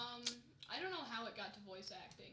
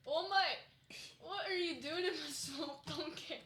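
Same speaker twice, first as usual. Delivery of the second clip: loud, high, slow Scottish ogre; hammed-up Scottish accent, drawn out; 5 semitones higher, 4 dB louder than usual. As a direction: put on a cartoonish Scottish brogue. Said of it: "I've developed a Scottish accent".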